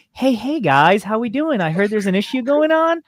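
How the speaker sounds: high-pitched, upbeat customer service voice; Aaron voice. Acted. Staffer taking an angry customer's call, put-on customer service voice. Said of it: "Can you please put the Aaron voice on?".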